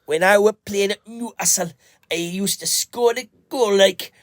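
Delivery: high Geordie footballer impression; Geordie accent. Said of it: "Gaz will come in and go".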